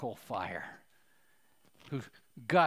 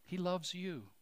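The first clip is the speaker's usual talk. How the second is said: ordinary talk, nothing marked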